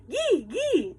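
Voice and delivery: high shout to the dogs. A narrator's reading. Girl on the sled shouting at the dogs, high. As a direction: yell the command at the team. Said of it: "I shouted".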